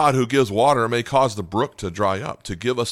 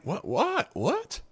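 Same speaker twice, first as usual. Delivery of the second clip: rising, much higher What, What, What in comic disbelief; incredulous squeaks; 5 dB quieter, much slower than usual. Retold or imitated. Preacher mimics Elijah's shock at the dried brook, incredulous. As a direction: squeak out a baffled What three times. Mocking Elijah's confusion, pitch jumps.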